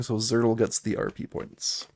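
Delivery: ordinary delivery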